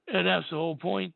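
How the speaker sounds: ordinary delivery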